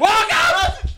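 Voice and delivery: high-pitched hollered greeting; yelled welcome. Acted. Host cutting off an argument to open show, yelled welcome. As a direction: yell it way up high.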